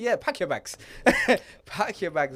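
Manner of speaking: high, loud and laughing dismissal; mock loud verdict. Retelling advice to a friend about his girlfriend, loud mock verdict. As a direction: call it out like a comic final judgement.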